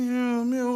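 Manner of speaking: high, zooming car noise; car whizzing past. Retold or imitated. Describing cars passing him on the A40, imitating their whoosh. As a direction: mimic cars flying by. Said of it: "this car's whizzing past me".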